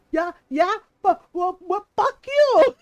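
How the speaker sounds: rising into a laughing yell, last words slow; imitating mom's comeback yell; mom yelling back. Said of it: "my mom apparently yelled".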